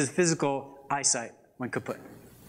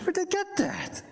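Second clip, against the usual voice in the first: high whispered disbelief; hushed, baffled aside; incredulous whisper. Real, not acted. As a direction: whisper it like a scandal.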